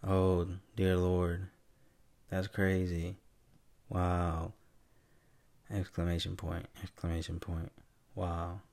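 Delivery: emotionless robot reading exclamations; robot dictation. Acted. Mocking how he should text Siri, low-key robotic. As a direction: dictate excitement with none in the voice. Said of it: "You want me to be a robot?".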